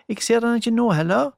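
calling out from the dark; called out. A narrator's reading. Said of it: "ropte hun".

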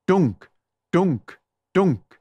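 loud thudding knock sound; imitated knock. A narrator's reading. Narrator voicing Julie's fists on the door, loud knock. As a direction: say it like a fist on wood.

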